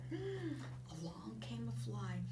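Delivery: hushed suspense whisper. Acted. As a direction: lean in and whisper it.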